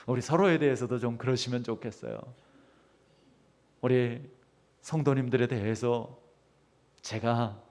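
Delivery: usual speaking voice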